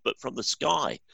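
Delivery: ordinary talk, nothing marked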